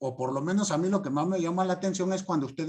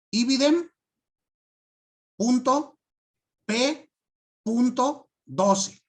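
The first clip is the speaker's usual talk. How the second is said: slow, spelled-out dictation, higher; dictating slowly; 6 semitones higher, 3 dB louder, slower than usual. Shown as a demonstration. Teacher dictating the citation to the class, slow and deliberate. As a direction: dictate each piece so students can write it. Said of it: "Lo único que voy a decir es".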